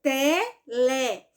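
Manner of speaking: "Telefone" in four slow beats; separated syllables. Shown as a demonstration. Teacher showing how to separate syllables, "Telefone" slowly split. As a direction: chop "Telefone" into te, le, fo, ne. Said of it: "você vai separar as sílabas de telefone".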